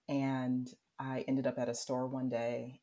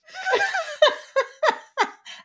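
very high, loud sobbing bursts; hammed-up bawling on repeated pulses; mock wailing; 10 dB louder than usual. Acting out breakdown before partner.

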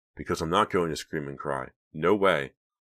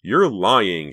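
louder, higher furious shout; 5 semitones higher, 10 dB louder than usual. A narrator's reading. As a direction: yell it at him in front of everyone. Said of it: "she shouted".

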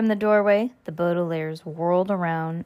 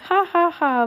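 high, forced ha-ha; fake laughter; 10 semitones higher than usual. A narrator's reading. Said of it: "faking all of this laughter".

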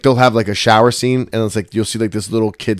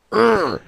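strained grunt; 12 semitones higher than usual. Retold or imitated. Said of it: "grunt of frustration".

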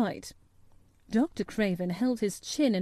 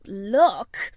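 one loud indignant word; outraged housekeeper; indignant exclamation; 6 dB louder than usual. A narrator's reading. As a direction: throw it back, loud and affronted. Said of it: "exclaimed Missus Medlock indignantly".

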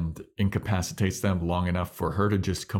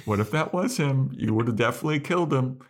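lightly raised, higher Buffy voice, partly rushed; a hint of a higher, anxious Buffy; cautious Buffy; 7 semitones higher than usual. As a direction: plead gently but quickly, voice a little up. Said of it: "instead she's like".

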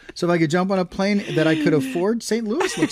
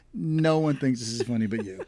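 normal talking voice

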